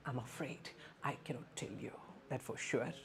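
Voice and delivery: soft, gentle and apologetic; soft polite tone. Shown as a demonstration. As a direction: break the news kindly and softly. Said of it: "you have to say like".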